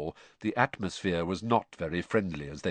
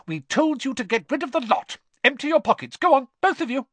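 high, loud, angry orders; exasperated mother shouting commands; furious scolding; 7 dB louder than usual. A narrator's reading. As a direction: bark orders at naughty children. Furious mother continues scolding her sons.

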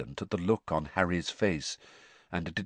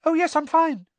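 very high, louder, rattled-off reassurance; unnaturally high gabble; 6 dB louder than usual. Acted. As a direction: gabble it out far too high. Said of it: "gabbled in the same unnaturally high voice".

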